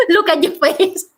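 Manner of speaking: breaking into laughter mid-sentence; laughing. Real, not acted. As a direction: crack up at the listener's reaction, voice rising. Amused by listener's disgusted face.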